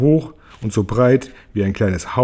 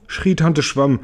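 usual speaking voice

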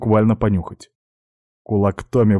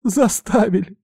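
tearful cry; 12 semitones higher, 4 dB louder than usual. Acted. Beaten student forced confession, crying.

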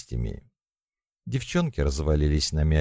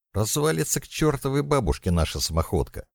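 high, exasperated protest; snapping back; 8 semitones higher, faster than usual. A narrator's reading. Mashka warns the old van will fall apart, snapping back. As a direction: complain testily, pitch up, volume contained. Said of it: "огрызнулась Машка".

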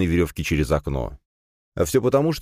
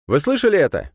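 high, raised, alert voice; startled question; 11 semitones higher, 4 dB louder than usual. A narrator's reading. Student outside hearing a noise, startled question. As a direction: call out as if you heard something.

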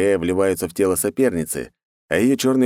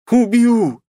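high, loud murderous scream; furious scream; 7 dB louder than usual. Acted. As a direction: scream the threat in rage. Enraged girl threatens. (яростный крик)

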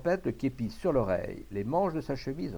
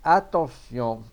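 slow, loud call to attention; suspenseful, long-held loud command; drawn-out warning; 9 dB louder, much slower than usual. A narrator's reading. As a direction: stretch the warning out before firing. Guard's final warning before firing.

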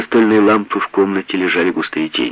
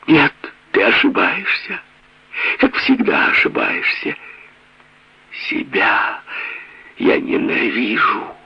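hysterical; 5 semitones higher than usual. Acted. Beaten wife screaming back hysterically.

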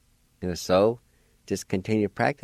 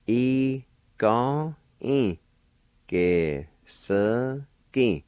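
ge-se-gi drawn out very slowly; slow demonstration; 3 semitones higher, much slower than usual. Shown as a demonstration. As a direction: stretch each syllable of E-ga-e, ge-se-gi for learners. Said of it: "And together".

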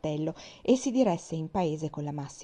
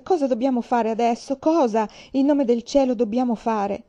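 loud, higher-pitched frantic question; desperate shout; 4 semitones higher, 7 dB louder than usual. A narrator's reading. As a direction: cry it out, begging for direction. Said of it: "gridò Charles".

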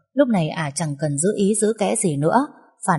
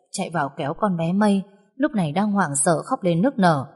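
ordinary delivery, nothing marked

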